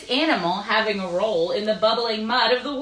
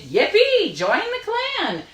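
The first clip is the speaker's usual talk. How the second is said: much higher, loud, slow cheer; cheerful shout; 12 semitones higher, 3 dB louder, slower than usual. Acted. The other three welcoming the warthog, a high loud cheer. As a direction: whoop out a joyful welcome. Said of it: "the other three shouted".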